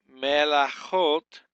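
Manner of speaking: melachot, spelled out slowly and loudly; slow Hebrew pronunciation. Shown as a demonstration. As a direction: lay out each syllable of melachot for the class. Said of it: "which is feminine plural".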